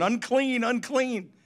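high calling cry of unclean; leper calling out. Retold or imitated. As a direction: call out a warning, high and loud. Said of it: "you had to run around in Israel going".

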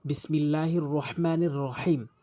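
usual speaking voice

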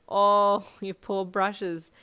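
slow, laughing sympathy; sympathetic laugh. A narrator's reading. Pitying his shredded toothbrushes, laughing sympathetically. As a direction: laugh kindly at his plight. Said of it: "you smiled sympathetically".

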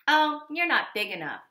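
loud high scoff; loud scoffing ladybug; dismissive. Acted. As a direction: brush them off.

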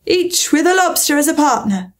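high, emphatic exclamation; crying out. A narrator's reading. Gryphon explains the dance steps, crying out. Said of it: "cried the Gryphon".

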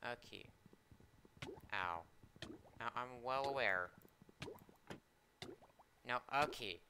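normal talking voice

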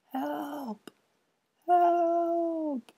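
very high wail fading away. Acted. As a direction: Pipkin carried off into the dark. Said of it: "wailed a fading voice".

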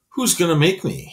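mock a smaller kid, menacing; bully taunting the boy who challenged him; snarled. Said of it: "he snarled".

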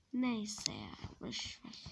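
whispered, slow, brushing it off; whispered aside. Acted. To the viewers after breaking something, in a slow whisper. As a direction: whisper so no one hears the slip.

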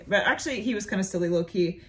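ordinary talk

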